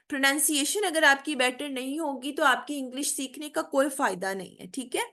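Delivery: ordinary delivery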